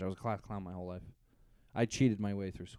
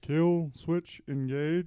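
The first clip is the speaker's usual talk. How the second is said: very slow, higher Transylvanian vampire accent; 6 semitones higher, 4 dB louder, much slower than usual. Shown as a demonstration. Repeating the band's name for a baffled co-host, Transylvanian accent. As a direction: draw out each word in hammed-up Transylvanian. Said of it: "not to talk like an idiot".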